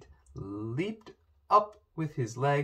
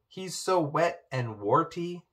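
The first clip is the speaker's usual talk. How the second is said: higher, slow, drawn-out complaint; reluctant complaint; 6 semitones higher than usual. A narrator's reading. Poppy objects to the frog to her father, complaining.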